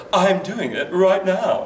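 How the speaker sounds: slow, lightly sultry woman's voice; a hint of a sultry woman; sultry female voice. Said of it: "Well, do a sultry female voice".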